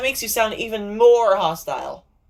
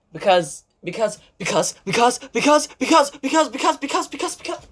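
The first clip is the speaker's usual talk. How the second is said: because after because, climbing higher till the voice squeaks; stammering excuse; 7 semitones higher than usual. Acted. Girl fumbling to explain the fight, rising flustered stammer. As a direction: spiral up in a flustered loop.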